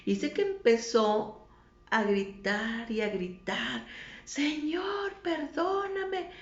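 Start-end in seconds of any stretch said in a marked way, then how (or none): 4.2-6.3 high, drawn-out plea; imitated desperate cry. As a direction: wail it upward like a far-off shout for mercy. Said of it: "gritaba con una desesperación". (clamor agudo y desesperado)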